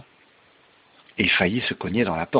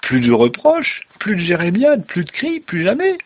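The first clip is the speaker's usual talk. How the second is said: climbing higher with each item, loud; gleeful; 11 semitones higher, 8 dB louder than usual. Acted. Rip telling Wolf the nagging is over, gleeful. Rip rejoices at his freedom.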